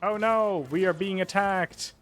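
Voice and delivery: loud, high, exasperated lament; mock alarm. Player complains about being attacked, loud mock alarm. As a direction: cry out in exaggerated dismay.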